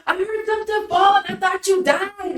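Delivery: high, frantic protest; retold panicked reply. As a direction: imitate yourself blurting it out in a panic. Retelling panicked reply to partner.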